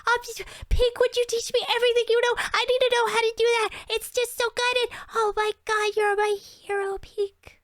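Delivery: put-on high, fast, starstruck child voice; breathless young fan begging to learn; gushing kid fan. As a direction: gush like an awestruck kid over a magic trick. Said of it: "they were like".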